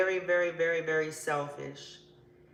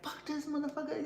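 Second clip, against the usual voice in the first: mock breakup vent; 9 semitones higher, 7 dB quieter than usual. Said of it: "be like".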